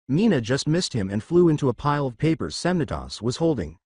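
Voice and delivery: ordinary delivery, nothing marked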